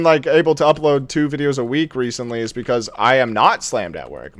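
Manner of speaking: ordinary talk, nothing marked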